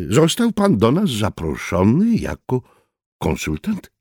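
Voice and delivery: rising incredulous question on "konsultant". A narrator's reading. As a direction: ask it with doubt. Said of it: "spytał Berlioz".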